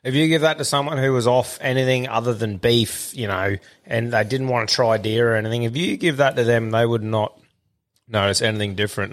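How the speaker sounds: normal talking voice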